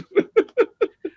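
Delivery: high laugh; high amused laugh; burst of laughter. Real, not acted. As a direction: laugh at your own joke. Laughs after joke.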